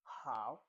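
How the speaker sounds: Mandarin third tone on HOW, falling then climbing. Shown as a demonstration. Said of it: "The third tone".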